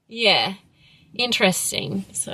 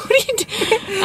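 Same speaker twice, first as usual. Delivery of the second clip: laughing; 14 semitones higher than usual. Real, not acted. Laughing at the other.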